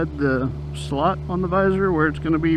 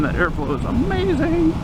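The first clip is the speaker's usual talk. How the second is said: excited; 11 semitones higher than usual. Real, not acted. Riding, exclaims at airflow.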